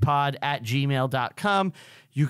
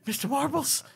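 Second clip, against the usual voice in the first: spooky whisper; 10 semitones higher, 4 dB quieter than usual. Retold or imitated. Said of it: "laying in bed".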